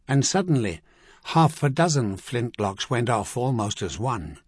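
ordinary delivery

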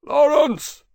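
very high, loud call; distant yell. A narrator's reading. Someone outside the camp calling Lawrence, yelled. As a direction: yell as if from far away. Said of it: "a voice yelled faintly from outside the camp".

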